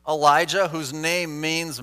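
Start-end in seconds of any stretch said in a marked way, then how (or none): none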